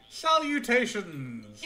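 drawn-out salutations; mock formal greeting. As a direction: announce it like a pompous gentleman. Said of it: "how he greeted me, by saying".

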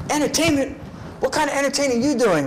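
high, incredulous drill sergeant. Retold or imitated. Drill sergeant scoffing at a dancing recruit, high and incredulous.

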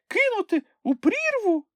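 a nervous little animal asking; squeaky Sniff. A narrator's reading. (високий писклявий голосок)

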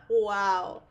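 loud laughter around a gasped wow; bursting laughter. Acted. Girlfriend laughing at the cheeky card from her date.

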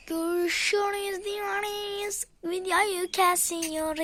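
drawn-out loud outro; slow sign-off. Acted. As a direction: stretch each word, announcing. (yavaş ve uzatarak kapanış)

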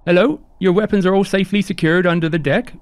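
high-pitched, apologetic crew member; chirpy high host voice; eager, polite ship hand Onion. Acted. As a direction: play a courteous, high-voiced deckhand, lilting upward.

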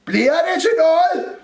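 high, loud impatient call; shouted question. A narrator's reading. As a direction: holler into the cupboard. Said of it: "råbte Rumle ind i skabet igen". (råber utålmodigt)

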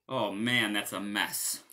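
plain reading voice

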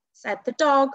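ordinary delivery, nothing marked

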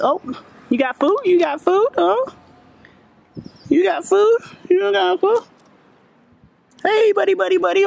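very high, sing-song pet voice. Real, not acted. Coaxing a street cat in high baby talk. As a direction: coo up high like talking to a kitten.

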